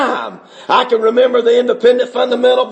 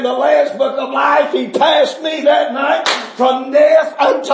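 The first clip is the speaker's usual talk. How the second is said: preaching at full force; 5 semitones higher than usual. Real, not acted. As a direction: cry it out to the church, building to a peak. Testimony rising to full preaching.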